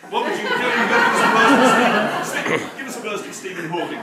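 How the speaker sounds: giggling, loud and high; laughing request. Real, not acted. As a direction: ask while laughing. Asking reader for voice.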